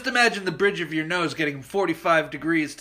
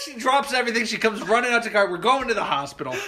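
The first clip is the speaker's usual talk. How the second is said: ordinary delivery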